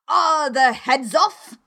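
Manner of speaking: commanding royal yell; shouted question. A narrator's reading. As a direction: shout it imperiously, stretching each word. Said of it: "shouted the queen".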